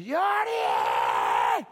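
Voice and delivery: long, drawn-out mock holler of a name; drunken fan yell. Man at the next table yelling at Jordi, long holler. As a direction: imitate a fan bellowing across a bar.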